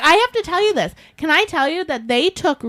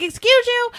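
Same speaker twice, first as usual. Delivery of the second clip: indignant retort; 10 semitones higher than usual. Retold or imitated. Confronting sighing shopper.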